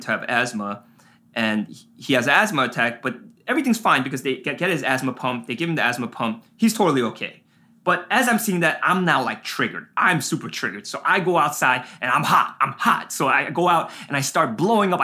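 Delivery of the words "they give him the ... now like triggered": ordinary delivery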